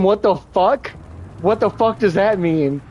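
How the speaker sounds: lightly voiced baffled viewer asking "What the fuck does that mean?"; a hint of someone who doesn't get the joke; confused asker. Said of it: "and when someone asks him".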